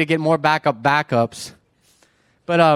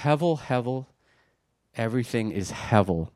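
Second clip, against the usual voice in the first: quiet reflective reading; 5 dB quieter than usual. Shown as a demonstration. Preacher showing how differently it reads, quiet reflection. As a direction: say it softly, letting it hang.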